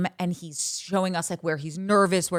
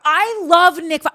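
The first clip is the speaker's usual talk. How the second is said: loud, high burst of adoration; emphatic declaration; 10 semitones higher, 10 dB louder than usual. Real, not acted. Fan confessing love for a TV bachelor, emphatic. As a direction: proclaim it at full volume.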